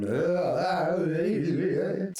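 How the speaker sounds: warbling pitch-shifted voice; wobbling pitch effect. Shown as a demonstration. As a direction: pitch sliding up and down. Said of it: "pitch is going up and down".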